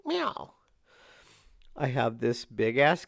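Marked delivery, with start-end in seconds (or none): 0.1-0.5 high mock cat meow; imitated meow. Owner meows to prompt the cat, very high.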